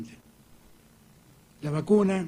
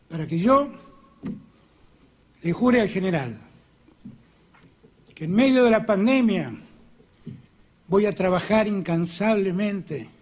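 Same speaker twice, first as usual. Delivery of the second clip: ordinary delivery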